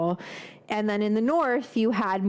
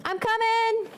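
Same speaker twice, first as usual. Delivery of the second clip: high called-out reply toward the door; sung-out I'm coming; calling out; 12 semitones higher than usual. Real, not acted. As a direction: call it out to the door.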